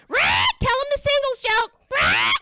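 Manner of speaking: very high, loud parrot screech; shrieking pet bird impression; squawking parrot. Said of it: "every time I look at my notes".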